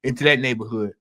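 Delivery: low, soft fatherly warning; a parent cautioning their son, low and hushed; parent's warning. Retold or imitated. Kid's parent warning son off a neighborhood, low and soft. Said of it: "They say".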